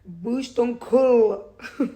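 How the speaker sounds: put-on child's stretched, loud «Bouge ton cul»; imitating her daughter. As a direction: copy a little girl with an English accent saying «Bouge ton cul». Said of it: "Ma fille, elle a dit".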